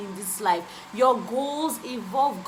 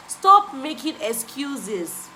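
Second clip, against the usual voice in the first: louder and slow, each word pressed; emphatic repeat; 4 semitones higher, 5 dB louder, much slower than usual. Real, not acted. Vlogger repeating her message to viewers, loud and slow. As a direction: hammer the point one last time. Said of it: "I've repeated it, like, four times".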